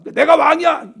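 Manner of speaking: high, loud mock protest; acted-out royal outburst; indignant king. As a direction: assert your rank over the soldiers. King protests to defiant soldiers.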